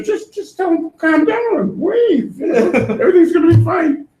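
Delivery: God telling Moses to wave it off; laughing breezy God. Retold or imitated. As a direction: toss it off with a chuckle. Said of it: "HaKadosh Baruch Hu would say".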